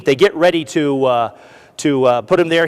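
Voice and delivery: ordinary talk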